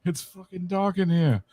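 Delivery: put-on a tentative Irish accent, slow, in the dead man's voice; a stab at an Irish accent, slow and half in jest. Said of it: "I can't do the Irish accent".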